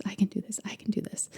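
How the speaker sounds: mantra sinking into a breathy whisper; fading to a whisper. Retold or imitated. Mom repeating her son's mantra, fading to a whisper.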